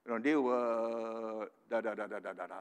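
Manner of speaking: loud scoffing Oh, then a trailing dismissive da da da; sarcastic imitation of a critic; mock objector. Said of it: "Don't come and tell me".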